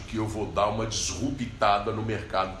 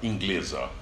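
ordinary talk

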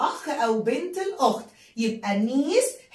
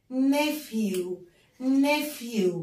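ordinary talk

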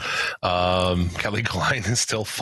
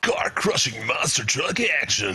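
slow booming promo voice; monster truck ad. Pretend. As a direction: hype it like a truck rally ad. Radio guy doing monster truck ad.

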